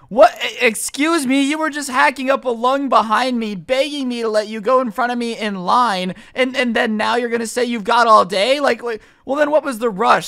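loud, high-pitched incredulous protest; indignant outburst. Retold or imitated. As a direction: fire back in exasperated disbelief. Indignant reaction to queue jumper.

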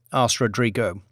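ordinary delivery, nothing marked

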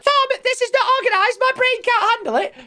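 high, whiny put-on voice mocking a fussy viewer; hammed-up whine of a viewer who can't stand mess. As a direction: pitch it way up like a panicking fan. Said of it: "for people who are freaking out about this".

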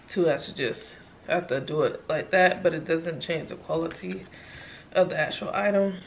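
quiet, low-energy tired talk; worn out after a long day. Real, not acted. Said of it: "As you can clearly see, tired".

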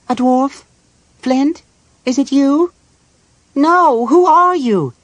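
high, loud frightened cries; high-pitched, distressed and confused; alarmed questioning. Acted. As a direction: call out in rising alarm at a stranger. Feverish Tass questions the dark figure.

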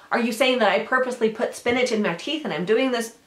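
loud mock-indignant comeback; pissy, defensive. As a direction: act thoroughly affronted by the accusation. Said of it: "you get yourself all pissy".

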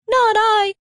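high, tiny mouse voice; squeaky mouse. A narrator's reading. Mouse refusing the hen, high and squeaky. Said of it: "said the mouse".

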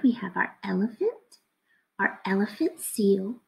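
quick hushed listing; whisper. Acted. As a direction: run through the animals in a whisper. Listing animals to children.

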